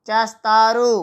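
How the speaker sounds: normal talking voice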